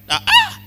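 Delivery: high, loud gasp of remembering; acted sharp "ah!" as it dawns; loud high exclamation, suddenly remembering; sudden realization "ah". Acts sudden remembering at the altar.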